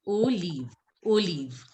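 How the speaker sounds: "Olive" as oh-LEEV; French pronunciation of olive. Shown as a demonstration. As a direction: model "Olive" with English O, then leave. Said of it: "same way you will pronounce O".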